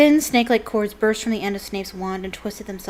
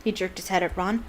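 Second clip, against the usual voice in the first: ordinary delivery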